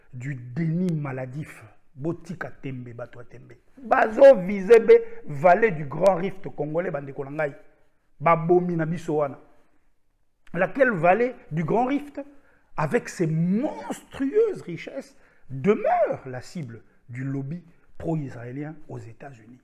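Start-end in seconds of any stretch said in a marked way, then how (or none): 3.8-6.9 forte emphase. Real, not acted. Harangue politique enflammée. 10.5-16.3 envolée oratoire. Real, not acted. Harangue politique enflammée.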